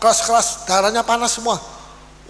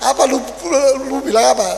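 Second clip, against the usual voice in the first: mock angry retort; 4 semitones higher, 6 dB louder than usual. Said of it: "Kabur dia".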